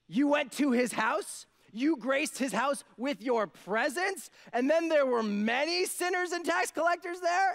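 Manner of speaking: high, loud, slow disbelief; incredulous. Acted. Disciples shocked that Jesus ate at Matthew's house, high and loud. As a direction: drag out each word in shocked disbelief.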